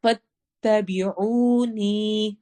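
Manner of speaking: فاتبعونی with عو and نی stretched the same length; two equal long vowels. Shown as a demonstration. Teacher showing equal madd in فاتبعونی, slow. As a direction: draw out both vowels in فاتبعونی evenly. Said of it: "عو واو مدہ ہوگا".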